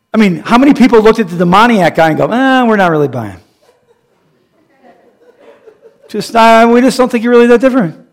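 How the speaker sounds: laughing, fast mock doubters; scoffing neighbors, half laughing; mock skeptic voice. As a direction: sneer at the changed man with a laugh. Mocking skeptics of the demoniac.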